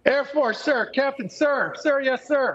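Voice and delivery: high, clipped military reply; barked sir yes sir. Pretend. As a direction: snap to attention like a cadet. Asked his rank, answers military-style.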